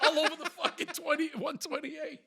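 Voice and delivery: high, loud comic rant; re-enacted exasperation. Driver describing the flyer mess to buddy, loud exasperation. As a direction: keep shouting through the absurdity.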